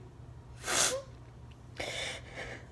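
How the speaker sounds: soft breathy sob; real sobbing without words; crying. Real, not acted. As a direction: break down in quiet sobs. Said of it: "they just kinda came out".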